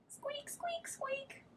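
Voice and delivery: very high, very soft squeaks; tiny mouse squeak. Acted. Mouse answering the cat with tiny squeaks.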